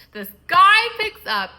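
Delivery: loud, slow, high outburst; indignant outburst. Real, not acted. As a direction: spit it out in disbelief. Angry retelling climax.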